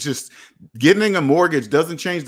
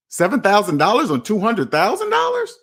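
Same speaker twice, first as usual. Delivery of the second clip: higher-pitched, disbelieving question; incredulous question; 6 semitones higher than usual. Acted. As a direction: question the figure in mock disbelief, pitch raised. Questioning a small down payment.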